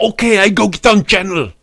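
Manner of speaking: high, loud mock Russian accent; mock Russian sidekick. Gregor agreeing to go on channel, hammy Russian accent. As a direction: answer loudly in broken-English Russian accent. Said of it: "And Gregor go".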